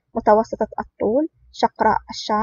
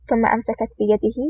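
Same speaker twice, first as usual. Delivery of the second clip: usual speaking voice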